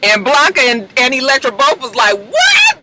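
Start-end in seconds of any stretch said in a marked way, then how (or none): 2.2-2.8 high-pitched mock shriek of disbelief; shocked outcry. Blanca and Elektra hear the secret, imitated shocked cry. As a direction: act out a stunned, shooting-up yelp. Two shocked characters react to revelation.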